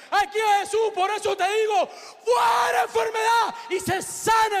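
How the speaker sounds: high, shouted deliverance rebuke; full-force preaching. Retold or imitated. Preacher rebukes sorcerers and casts out sickness, shouting. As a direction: hurl each phrase out like an exorcist.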